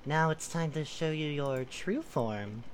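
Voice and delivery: slow, low, silky voice; buttery smooth. Acted. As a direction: purr it out smoothly. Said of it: "buttery smooth".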